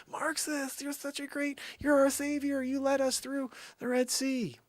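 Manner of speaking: very high mock worshipper; high, put-on fawning praise; adoring crowd voice. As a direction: gush in a squeaky falsetto.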